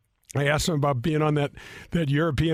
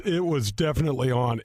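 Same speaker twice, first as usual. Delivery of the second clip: ordinary talk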